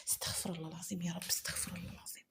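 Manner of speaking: quick soft whisper asking forgiveness; whispered prayer. Acted. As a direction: hide the prayer from everyone, barely a breath. Selim repeats prayer to himself.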